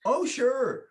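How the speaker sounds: high, obliging reply; eager agreement. Acted. Eager extra agreeing to director.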